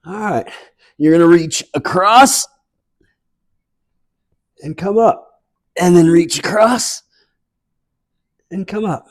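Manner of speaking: bright, lifted workout cues over music; fitness instructor cueing. Real, not acted. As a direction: call the moves like an aerobics coach. Fitness class movement cues.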